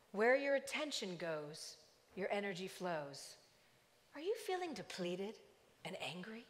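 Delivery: calm loudspeaker voice. Retold or imitated. Walmart loudspeaker addressing the parking lot, calm and soothing. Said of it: "A very calm voice over the loudspeaker".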